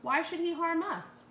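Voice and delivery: worried wife. A narrator's reading. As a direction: plead your worry. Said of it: "said Missus DeSoto".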